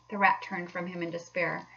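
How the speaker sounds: ordinary delivery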